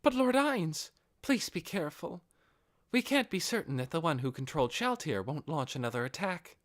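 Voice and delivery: a concerned servant warning her lord; worried warning. Acted. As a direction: plead with him to be careful. Albedo warns her lord.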